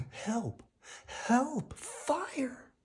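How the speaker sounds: high, rising screams for help; panicked cries. Acted. Woody screaming to the twins as fire approaches, high panic. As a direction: call out desperately as flames near.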